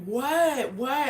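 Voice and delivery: high, mimicked clueless question; out-of-it drunk girl. Drunk girl asked to pick up her shirt, out of it. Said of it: "like, she's out of it".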